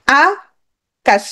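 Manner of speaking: আ and কাশ with a long gap between; broken into syllables. Shown as a demonstration. Teacher asking how many syllables, আ - কাশ split apart. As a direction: say আ alone, pause, then কাশ. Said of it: "আমরা ভেঙে উচ্চারণ করি".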